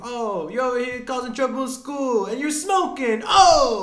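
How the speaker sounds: high, loud, hammed-up scolding that builds to a big "Oh!"; mock outraged Italian uncle; imitated Uncle Dick scolding. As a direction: play the scandalized uncle, rising to a shout. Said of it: "He's like".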